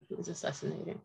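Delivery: sinking to a near hush on the repeat; hushed, stunned repetition; quiet repeat. Real, not acted. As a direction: let it fall away, almost to yourself. Repeating the news, softly.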